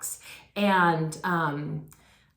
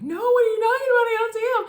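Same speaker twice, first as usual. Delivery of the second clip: put-on high, loud, fast confused child's cry; frantic child; 6 dB louder, much faster than usual. Little Keisha at the window, high frantic confused cry. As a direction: wail it out as a bewildered kid. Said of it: "I'm like".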